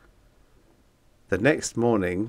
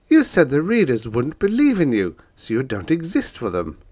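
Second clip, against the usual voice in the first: protesting; 6 semitones higher, 5 dB louder than usual. Acted. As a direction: push back in protest. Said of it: "I protested".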